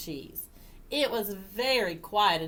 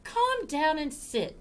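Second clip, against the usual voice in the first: high, slow cat voice; slow, high-pitched scolding cat; cat character; 8 semitones higher than usual. Acted. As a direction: settle the dog down, slowly and high. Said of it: "said the cat".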